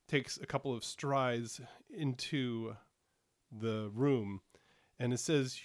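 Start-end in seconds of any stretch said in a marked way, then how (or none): none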